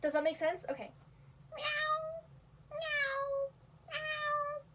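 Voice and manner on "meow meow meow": high cat-like meow, whole word pronounced. Shown as a demonstration. Teaching viewers the basic meow, high cat voice. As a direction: meow like a real cat, not a human. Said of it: "a meow, you're going to try and pronounce the whole thing".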